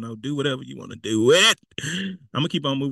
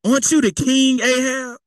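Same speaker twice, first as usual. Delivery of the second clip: loud, high-pitched egging on; Jezebel; 9 semitones higher, 5 dB louder than usual. Acted. Jezebel trying to gas up King Ahab, goading encouragement. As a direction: puff him up with conniving flattery. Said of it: "See, she's trying to gas him up".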